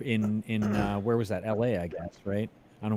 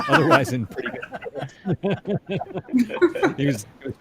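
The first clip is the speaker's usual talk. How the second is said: laughing through the words; 9 semitones higher, 6 dB louder than usual. Real, not acted. Guest joking about Scorsese, laughing aloud. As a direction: giggle while saying it.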